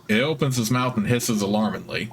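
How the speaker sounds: ordinary delivery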